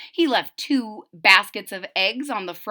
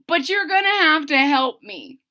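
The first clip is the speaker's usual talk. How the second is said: pleading; 7 semitones higher, 6 dB louder than usual. Acted. Sick Wilbur pleads.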